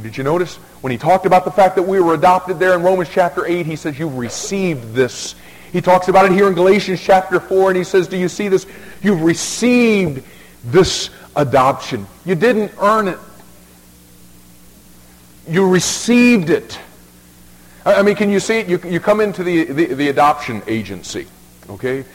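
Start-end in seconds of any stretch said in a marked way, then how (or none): 0.0-8.7 loud, high, driving sermon climb; preaching at full force. Acted. Preaching builds up. 9.0-10.2 "received" stretched slow and loud; stress on received. Shown as a demonstration. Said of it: "I keep emphasizing this word". 10.6-18.8 loud, high, driving sermon climb; preaching at full force. Acted. Preaching builds up.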